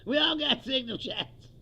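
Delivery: high, loud sarcastic impression of a shrugging official; mock dismissive official. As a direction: ham up the carefree denial, high and loud. Mocking officials' dismissive line.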